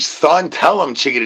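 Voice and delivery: ordinary talk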